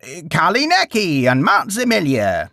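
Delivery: grandma voice. Acted. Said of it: "He tried the voice again".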